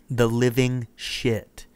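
"living shit" drawn out slowly, word by word; slow emphatic repeat. Acted. Drug-craving inner voice repeats itself to the cop, slow and emphatic. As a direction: spell out "The living shit" with relish. Repeats after asking "the what now.

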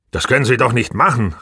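usual speaking voice

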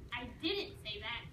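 ordinary delivery, nothing marked

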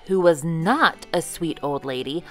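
ordinary delivery, nothing marked

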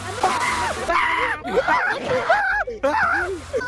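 high loud screaming laugh; shrieking scared laugh. Real, not acted. Gamer startled, shrieking with laughter.

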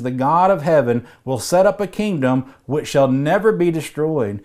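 normal talking voice

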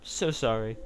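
high, fake sorry tone; sarcastic, sing-song apology; mock sympathy. Real, not acted. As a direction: pretend to pity the opponent. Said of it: "I'm really not".

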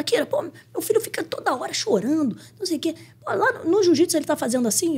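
high-pitched worried grumbling; imitating complaining mother. Mother complaining to the coach about her crying son, mock exasperation.

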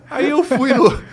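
loud, high laughter spilling into words; laughing. Real, not acted. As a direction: keep laughing as you restart. Still laughing after punchline.

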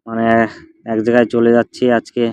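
ordinary talk, nothing marked

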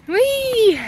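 high, drawn-out playful "whee". Real, not acted. Mum to her baby in the pram, playful high whee.

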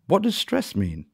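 put-on high puzzled child question; confused. A narrator's reading. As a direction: ask it high, genuinely puzzled. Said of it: "asked the confused Ella".

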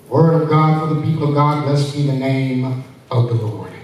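ordinary talk

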